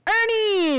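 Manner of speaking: loud, high call across the store. Acted. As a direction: shout his name out in surprise. Guy calls out old name.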